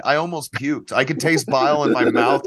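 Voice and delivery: ordinary talk, nothing marked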